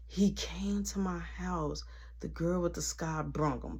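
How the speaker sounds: slow hushed excuse; a soft, slow retold whisper. Girl explaining quietly.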